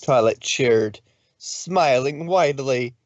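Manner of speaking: ordinary delivery, nothing marked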